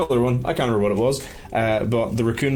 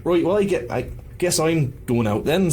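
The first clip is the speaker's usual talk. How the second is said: departing thug in high Belfast voice; high-pitched Belfast accent; 8 semitones higher than usual. Acted. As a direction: play the wee Belfast henchman. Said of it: "this is what this guy sounds like".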